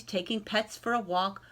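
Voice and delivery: plain reading voice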